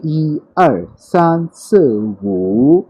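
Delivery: "ई" high, "आर" and "स" falling, "वू" dipping then rising; counting one to five as music. Shown as a demonstration. Teacher reciting one to five in tones as a melody. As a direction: sing the count to five like a tune. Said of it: "as a music याद रख लीजिए".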